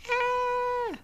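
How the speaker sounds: long, high, loud "ah"; sustained high-pitched squeal; startled cry. Real, not acted. Host interrupted during live stream, high startled cry.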